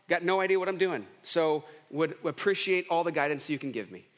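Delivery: usual speaking voice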